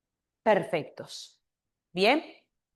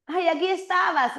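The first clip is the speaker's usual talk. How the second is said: surprised friend; 8 semitones higher than usual. Shown as a demonstration. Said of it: "y sigues ahí".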